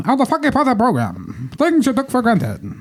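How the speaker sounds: put-on old-timey announcer. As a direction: read it like a vintage radio host. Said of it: "uh, old-timey voice".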